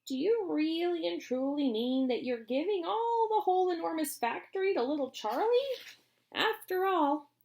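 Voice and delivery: put-on high old man's voice, amazed; an old man who can't believe it; Grandpa Joe. Grandpa Joe asks Wonka about the factory, amazed.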